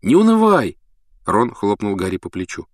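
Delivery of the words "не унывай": cheerful. A narrator's reading. Ron cheering Harry up.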